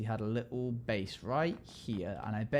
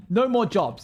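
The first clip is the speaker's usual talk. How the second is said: loud; 9 semitones higher, 11 dB louder, slower than usual. Retold or imitated. Quoting the routine.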